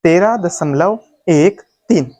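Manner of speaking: slow, एक, तीन as separate digits; correct reading demo. Shown as a demonstration. Teacher showing the right way, slow digit-by-digit reading. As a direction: space out एक and तीन slowly. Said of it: "इसको सही पढ़ने का तरीका".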